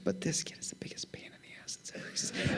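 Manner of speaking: a sly whispered confession; whispered aside. Real, not acted. Dad whispering his complaint about his son to the congregation, sly whisper. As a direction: whisper it so the congregation barely hears.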